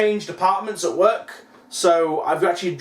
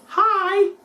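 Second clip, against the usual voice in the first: dog's voice; 13 semitones higher than usual. Pretend. Voicing the dog on camera.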